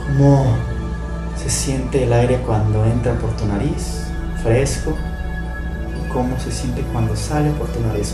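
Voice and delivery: calm guided meditation voice; right in your ear. Acted. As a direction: guide them gently into stillness.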